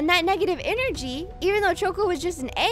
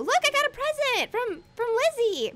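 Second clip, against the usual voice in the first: ordinary talk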